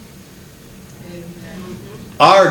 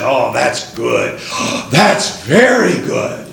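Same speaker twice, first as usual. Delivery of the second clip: God's voice; 6 semitones lower, slower than usual. Acted. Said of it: "when he looked at it all and said".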